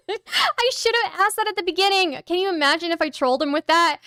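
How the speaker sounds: high, giggling through the lines; laughing. Real, not acted. Streamer to chat, laughing about trolling the opponent. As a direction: still cracking up at the idea.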